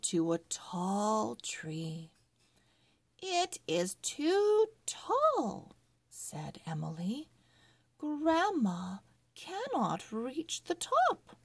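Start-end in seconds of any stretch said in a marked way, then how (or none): 3.2-5.8 put-on higher and slow, a small girl complaining; little girl voice. A narrator's reading. Girl Emily voiced in story. 8.0-11.4 put-on a touch of a small girl, higher; lightly voiced little girl objecting. A narrator's reading. Girl Emily voiced in story.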